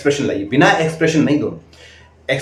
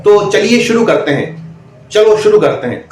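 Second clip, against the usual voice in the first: higher, louder teacher calling the class to start; loud classroom opener; 6 semitones higher, 3 dB louder than usual. Retold or imitated. Mimicking a candidate's wrong demo opener, loud and high. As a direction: boom it out like a teacher opening class. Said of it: "आप इस तरह बोलते हैं demo में".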